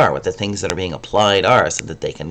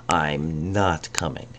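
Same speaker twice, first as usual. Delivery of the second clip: "not" drawn out slowly and softly; emphatic not; 4 semitones lower, 7 dB quieter, slower than usual. Shown as a demonstration. Teacher showing emphasis inside a contraction. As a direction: lean on "not" even with "I'm". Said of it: "I would even use the word I'm".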